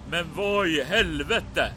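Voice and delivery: tormentor's angry outburst. Acted. Tormentor discovers the escape from the bedroom, indignant outburst. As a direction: exclaim as the startled tormentor. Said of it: "rösten från din plågomästare som säger".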